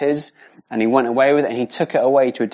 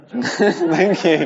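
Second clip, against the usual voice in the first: slow thanks through a laugh; bashful laughing thank you; 5 semitones higher than usual. Real, not acted. Thanked after reading, laughing.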